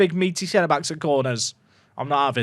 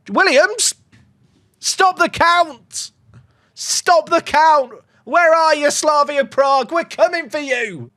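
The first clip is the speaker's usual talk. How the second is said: excited shout; 8 semitones higher, slower than usual. Real, not acted. Celebrating a goal, shouting.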